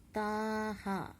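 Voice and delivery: "Ta ha" with both vowels held long. Shown as a demonstration. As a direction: stretch each "a" in "Ta ha". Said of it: "read in a little long accent".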